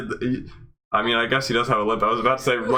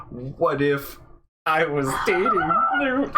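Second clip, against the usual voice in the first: mock dreamy question; 4 semitones higher than usual. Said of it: "you could just say".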